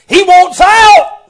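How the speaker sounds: high, loud, slow preacher's shout; preached cry. Shown as a demonstration. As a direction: hammer it home to the church. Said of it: "repeated, second preached".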